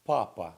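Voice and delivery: Papa said loud and clear, PA-pa, stress on the first syllable; teacher models Papa loudly for the class; model pronunciation. Shown as a demonstration. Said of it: "The first one, simple".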